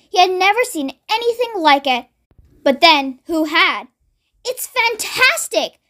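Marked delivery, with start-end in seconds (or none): 4.4-5.8 high, drawn-out exclamation of delight; thrilled shout. A narrator's reading. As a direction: stretch it out, overjoyed, pitch up. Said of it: "Mister Cranky shouted".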